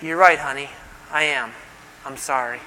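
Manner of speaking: quiet, gentle giving-in; soft apology. Retold or imitated. Husband softly conceding to his wife, quiet and meek. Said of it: "If you just say".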